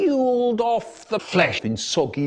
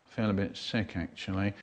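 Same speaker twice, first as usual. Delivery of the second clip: low, soft, sickly mumble; queasy; 7 semitones lower, 8 dB quieter than usual. Acted. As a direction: admit nausea under your breath. Queasy admission after tasting pie.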